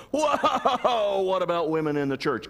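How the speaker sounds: high, startled whoa falling into a question; mock alarm. Preacher voicing alarm over a controversial topic, mock alarm. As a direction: cry out in hammed-up alarm.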